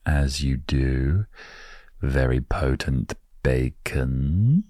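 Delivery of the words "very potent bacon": ordinary talk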